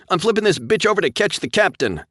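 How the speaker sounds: high, loud reckless shout; defiant shout. A narrator's reading. Pilot telling crew his plan, defiant and loud. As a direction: shout it, all in. Pilot declaring risky maneuver.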